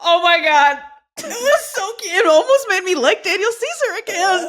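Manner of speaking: high-pitched delighted gush. Real, not acted. Listener swoons over cute voicemails, gushing. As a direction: squeal it out, swooning.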